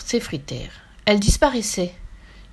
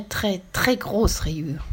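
usual speaking voice

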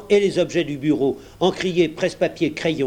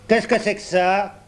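irritated; 6 semitones higher than usual. A narrator's reading. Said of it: "d'un ton irrité".

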